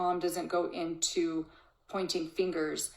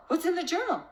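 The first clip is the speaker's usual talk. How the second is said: more panicked; 11 semitones higher, faster than usual. Retold or imitated. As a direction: escalate the alarm on the repeat. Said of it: "Instead of the mom going into distortion".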